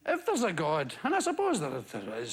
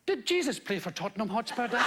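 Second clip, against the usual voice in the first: put-on English accent; faster than usual. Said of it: "Pretty good English accent".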